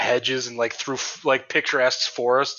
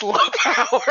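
high robotic voice through laughter; robot readout; 10 semitones higher, 6 dB louder than usual. Retold or imitated. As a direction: read it like a machine, laughing. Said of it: "and it goes".

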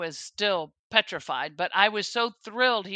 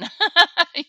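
a burst of bright, real laughter, higher and louder; 11 semitones higher, 12 dB louder than usual. Real, not acted. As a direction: laugh out loud at yourself. Laughs at her own advice.

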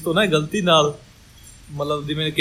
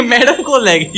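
dramatic loud aunty; 5 semitones higher, 5 dB louder than usual. Acted. Dramatic gossip climax.

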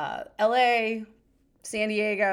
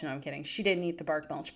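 ordinary talk

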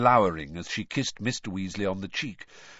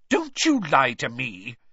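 slow, louder, emphatic scolding; stern warning; 5 semitones higher, 6 dB louder than usual. Acted. Mother confronting her lying son, stern. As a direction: spell out the warning word by word.

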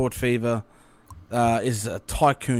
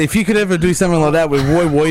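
normal talking voice

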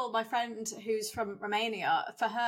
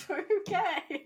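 high, drawn-out uneasy okay; a presenter at a loss, pitched up; awkward okay; 9 semitones higher than usual. Retold or imitated. The presenter reacting to the crash, with a high awkward okay.

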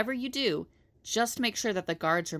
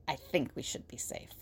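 hushed aside; 4 semitones lower, 7 dB quieter than usual. Acted. Aside after Zari joke.

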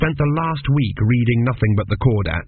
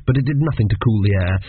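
ordinary delivery

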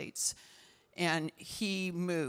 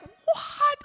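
very high, loud exclamation; re-enacted shocked shriek; retold astonishment; 11 dB louder than usual. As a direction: burst out in amazed disbelief. Acts out astonishment at news.

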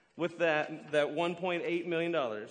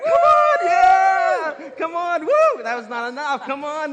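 much higher, louder whooping shouts; hyping the crowd; 10 semitones higher, 15 dB louder, slower than usual. Real, not acted. Pastor shouting to the congregation to cheer the servant. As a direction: whip the room into cheering.

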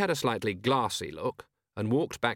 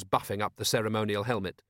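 ordinary delivery

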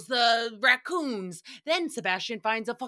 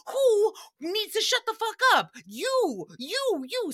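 angry yell; 11 semitones higher, 3 dB louder than usual. Retold or imitated. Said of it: "And he's like".

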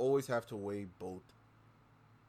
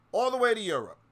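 much higher and louder, stressing way; indignant shout; 12 semitones higher, 9 dB louder than usual. Real, not acted. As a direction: hurl it out in outrage. Repeats line much louder.

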